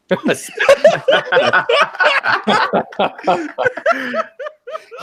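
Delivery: long, loud high-pitched laughing fit; helpless extended peal of laughter. Real, not acted. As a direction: completely lose it laughing. Cracks up at own word.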